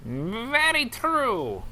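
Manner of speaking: high, very slow put-on voice; drawn-out agreement. Host humours a silly remark, high drawn-out voice. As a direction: stretch each word in a high voice.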